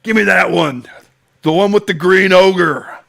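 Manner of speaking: loud, high colonel voice; loud gung-ho soldier. Retold or imitated. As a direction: demand it like the colonel. Continues the colonel voice.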